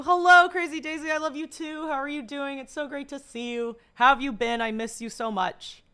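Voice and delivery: high, loud, gushing hello; greeting a viewer with high excitement; excited greeting. Real, not acted. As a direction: squeal a happy hello to a friend.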